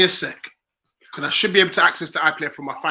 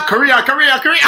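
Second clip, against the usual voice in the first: retold shout; 11 semitones higher, 14 dB louder than usual. Retelling how a man ran and shouted, very loud mock yell. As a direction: imitate a fan's very loud, high chant. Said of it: "and then he shouts".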